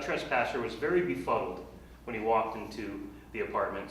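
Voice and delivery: ordinary talk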